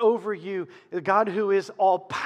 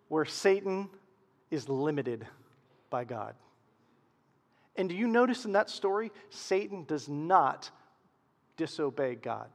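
usual speaking voice

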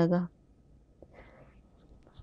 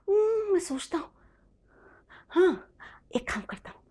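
musing quietly; 8 semitones higher, 7 dB quieter than usual. Acted. Boy thinking aloud.

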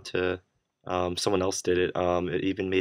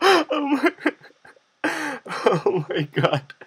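breathless high laughter; laughing; 6 dB louder, slower than usual. Real, not acted. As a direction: laugh so hard it squeaks. Laughing at troll result.

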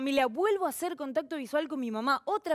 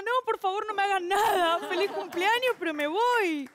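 mock panic; 7 semitones higher, 4 dB louder than usual.

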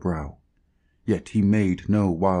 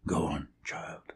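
hushed, much softer whisper; 9 dB quieter than usual. Acted. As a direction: breathe it gently to the dying girl. Comforting a dying girl.

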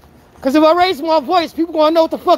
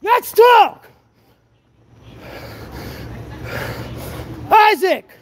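shouting; 6 semitones higher, much slower than usual. Real, not acted. Chasing him, calling out.

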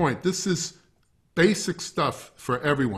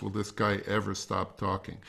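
mock weary; 6 semitones lower, 7 dB quieter than usual. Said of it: "like you're sighing because".

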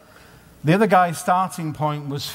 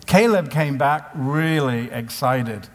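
ordinary delivery

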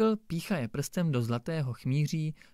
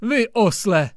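loud, higher burst of scorn; blurting insult; 8 semitones higher, 10 dB louder, slower than usual. A narrator's reading. Judge blurting an insult at the stubborn brickmaker. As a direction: blurt it out in exasperation. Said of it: "vyhrkl soudce".

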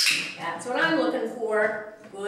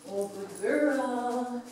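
ordinary talk

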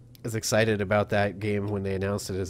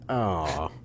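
disappointed groan; 5 semitones lower than usual. Retold or imitated. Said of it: "they're just like".

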